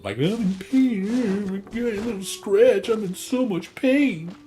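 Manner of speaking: overdone crybaby over a scratch; mocking whiny complainer. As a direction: wail about a little scratch like a baby. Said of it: "some people that go".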